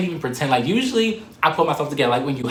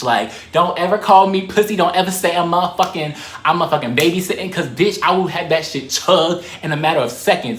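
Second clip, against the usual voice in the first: loud boast; 5 semitones higher, 5 dB louder than usual. Real, not acted. Bragging about chugging drinks.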